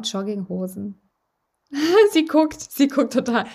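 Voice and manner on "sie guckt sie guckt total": laughing through the words; giggling while teasing. Real, not acted. Laughing while teasing her cohost.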